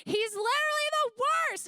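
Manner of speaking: high, loud playful outburst; mock shout. Telling the kids the king is the worst, loud mock shout. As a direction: belt it out to rile up the kids.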